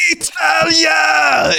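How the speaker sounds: drawn-out high triumphant shout of Italia; stretched, soaring victory cry; yelling Italia. Retold or imitated. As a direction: belt it out like a thrilled Italian record-breaker. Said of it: "he just started yelling".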